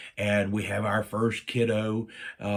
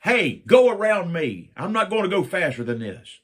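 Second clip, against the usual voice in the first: mock call out; 7 semitones higher, 6 dB louder than usual. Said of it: "I'm like".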